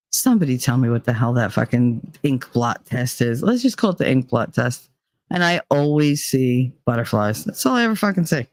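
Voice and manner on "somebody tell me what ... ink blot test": ordinary talk, nothing marked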